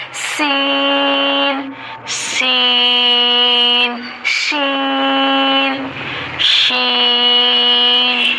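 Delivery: سین and شین drawn out very slowly with a hissing whistle; whistling seen. Shown as a demonstration. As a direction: let سین whistle as you hold it. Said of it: "سین پڑھتے ہوئے سیٹی کی آواز آتی ہے". (سین میں سیٹی کی آواز)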